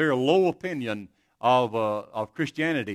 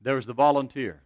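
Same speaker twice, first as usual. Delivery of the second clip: usual speaking voice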